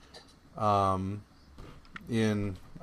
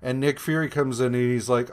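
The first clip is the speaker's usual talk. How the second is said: ordinary delivery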